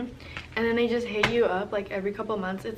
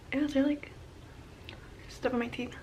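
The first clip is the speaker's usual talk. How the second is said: whispering; 2 semitones higher, 8 dB quieter than usual. Real, not acted. Checking teeth, self-conscious aside.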